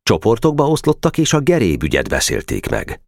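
ordinary delivery

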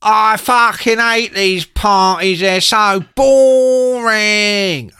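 very slow, high, loud whining bellow. Retold or imitated. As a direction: drag out every word in an obnoxious punk yell. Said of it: "And he's like".

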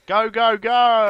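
loud excited race-commentator shout; Murray Walker commentary. Pretend. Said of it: "We gotta put a bit of Murray Walker in there".

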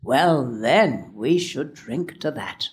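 slow, low father's toast; measured, deep-set toast from father; slowly pronounced. A narrator's reading. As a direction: say it slowly and low, dropping away at the end. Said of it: "Father would slowly pronounce the words".

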